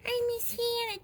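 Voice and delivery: high, soft mock whimper; make-believe tearful complaint; pretend crying. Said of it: "She's crying".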